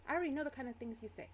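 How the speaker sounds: high, quick teacher's rebuke; dismissive scolding. Acted. Teacher to a silenced student, dismissive. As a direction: brush off the excuse quickly.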